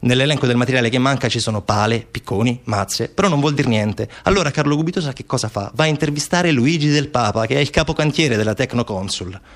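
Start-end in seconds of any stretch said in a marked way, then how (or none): none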